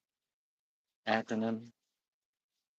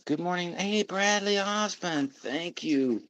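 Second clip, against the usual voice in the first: bright call-out, pitched up and slowed; cheery greeting; 8 semitones higher, 3 dB louder than usual. Real, not acted. Greeting a viewer in the chat, pitched up and louder.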